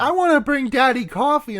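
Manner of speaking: put-on high-pitched child voice; little daughter. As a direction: pipe up like an eager little girl. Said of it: "his daughter is like".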